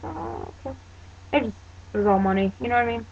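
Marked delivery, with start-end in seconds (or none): 0.0-0.8 high, soft "oh, okay"; mildly thrown, higher and softer; a touch of surprised retreat; taken aback. Retold or imitated. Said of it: "I'm like".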